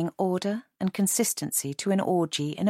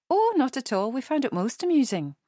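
high, amused Edinburgh lady; Edinburgh accent; 8 semitones higher, 3 dB louder than usual. A narrator's reading. Elderly lady reassuring embarrassed Michael, Edinburgh accent. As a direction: wave off the apology in Edinburgh tones. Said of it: "in an Edinburgh accent".

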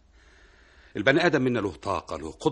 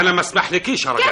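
indignant protest; 9 semitones higher, 8 dB louder, faster than usual. Acted. Husband protests when caught.

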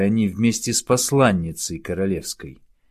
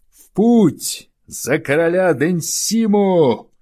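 much higher, loud battle cry; rallying cry; 9 semitones higher, 5 dB louder, slower than usual. Acted. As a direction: rouse the crowd to march. (громкий призывный клич)

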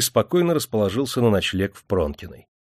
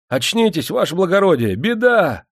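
high, loud, urgent alarm; rousing shout; 9 semitones higher, 5 dB louder than usual. A narrator's reading. Sergeant wakes the sleeping major with bad news, urgently. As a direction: shout him awake, climbing to the last word. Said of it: "раздался над ухом отрезвляющий голос".

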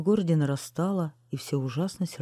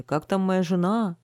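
raised voice, a little louder and higher; men shouting; 4 semitones higher, 5 dB louder than usual. A narrator's reading. Men asking after a wife, calling out. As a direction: call out the question. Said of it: "А мужчины кричали".

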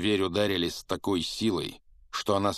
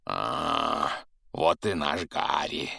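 slow, pleased low voice; satisfied; 3 semitones lower, much slower than usual. A narrator's reading. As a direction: savor the moment, content. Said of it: "удовлетворённо произнёс великан". (довольно, неспешно)

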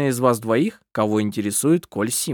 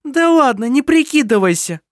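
scornful interruption; 12 semitones higher, 6 dB louder than usual. A narrator's reading. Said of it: "перебила Лисин".

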